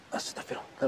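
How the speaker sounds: soft whispered astagfirullah; whispered prayer. Real, not acted. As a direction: breathe the word out in dread. Shocked at what he sees.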